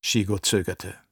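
plain reading voice

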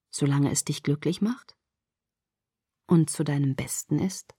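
soft, low, soothing reassurance; lullaby-like. A narrator's reading. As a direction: lull a drowsy listener to sleep. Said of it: "Seine Stimme war so schön wie ein Wiegenlied".

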